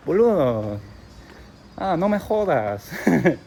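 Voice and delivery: lightly mocked Argentine Spanish; Argentine accent. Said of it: "I like Argentine Spanish the most".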